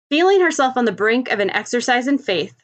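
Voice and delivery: ordinary delivery, nothing marked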